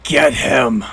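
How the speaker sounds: a lightly voiced villainess barking an order; hint of a wounded villain; dragon lady command. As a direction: snap the order at the party. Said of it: "and she goes".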